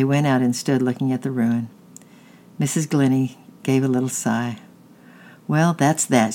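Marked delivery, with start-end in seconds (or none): none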